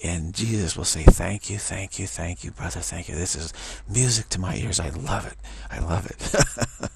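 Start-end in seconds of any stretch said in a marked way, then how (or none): 1.0-3.2 a sudden loud burst of thanks. Retold or imitated. Voicing Jesus thanking. 5.7-6.9 slow drawn-out love. Retold or imitated. Voicing Jesus drawn out.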